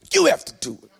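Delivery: YOU punched high and loud, the rest dropping away; forceful exclamation. Shown as a demonstration. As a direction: hit "You" hard, like an exclamation mark. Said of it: "Gotta say it just like that".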